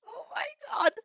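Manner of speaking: very high squealing oh my god; squealing in glee; real squeal. Real, not acted. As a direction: overcome with delight. Reader gushes at hug.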